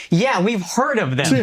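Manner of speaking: imitating his wife's deadpan as if the team were no big deal; wife teasing the rich girl, mock casual; mock offhand. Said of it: "she's saying it like we haven't heard of it".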